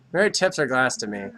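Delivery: ordinary talk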